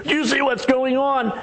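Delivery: high, slow alarmed question; panicked servant. Retold or imitated. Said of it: "when he comes in".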